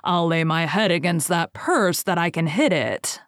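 ordinary delivery, nothing marked

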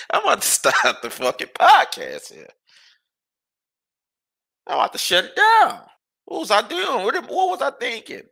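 can't believe he rooted for him; exasperated. Real, not acted. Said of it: "I'm so mad right now".

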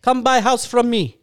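loud mock Russian salesman; Russian accent. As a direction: pitch the house in Russian accent. Mocking Russian realtor.

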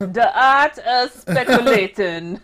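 slow, drawn-out Jamaican imitation; mock Jamaican. Co-host mimicking the narrator's title, laughing mock Jamaican. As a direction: stretch it out in a put-on Jamaican accent. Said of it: "Had that deep Jamaican accent".

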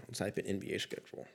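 whispered, fast mutter to himself; a quick breathy aside to himself; muttered under breath. Real, not acted. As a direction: mumble it while typing, barely voiced. Muttering while typing a search.

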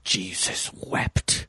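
low, slow, breathy villain quote; Hellraiser line. Retold or imitated. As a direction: drop low and drag it out like a movie villain. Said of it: "I forgot he said that".

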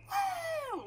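shrill high "woo", imitated; high-pitched scream. Friend imitating the guy's scream, high-pitched squeal. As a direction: mimic someone screaming for no reason. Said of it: "Like a high-pitched scream?".

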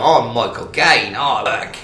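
hammed-up Cockney film star impression; Michael Caine. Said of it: "that's how he sounds".

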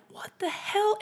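high, baffled what the hell; a startled mayor's high-pitched what the hell. Retold or imitated. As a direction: look around stunned and ask it. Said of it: "he's looking around like".

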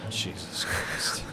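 breathy whispered aside. Real, not acted. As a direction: whisper it under your breath. Aside after offensive joke.

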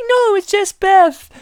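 slow, high, loud falsetto; mock secretary insisting on her name; high put-on assistant voice; secretary correcting boss.

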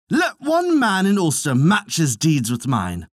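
high, ringing warrior's challenge; defiant challenge. A narrator's reading. Cecht challenging the Ulster heroes, high defiant call. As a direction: dare every man in the hall to match him. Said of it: "he cried".

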